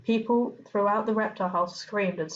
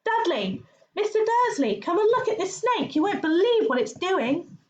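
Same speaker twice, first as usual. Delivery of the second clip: yelling at the top of his lungs; high, loud excited shout; deafening shout; 12 semitones higher, 4 dB louder than usual. Acted. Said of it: "a deafening shout behind Harry".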